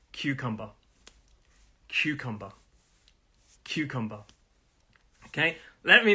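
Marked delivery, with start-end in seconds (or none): 0.1-4.2 "cucumber" stressed on CU, falling softly away; first-syllable stress. Shown as a demonstration. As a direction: lean on the first syllable of "cucumber", soft on the rest. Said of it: "The stress is on the first syllable".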